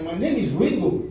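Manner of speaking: normal talking voice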